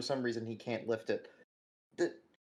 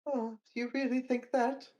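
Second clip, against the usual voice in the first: teary high woman voice; 10 semitones higher than usual. Acted. Weeping woman NPC replies.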